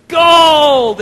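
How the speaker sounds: high, very loud gleeful shout; yelled. Acted. Kiwi copies the wish on the coaster, high loud shout. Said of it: "he says the same thing".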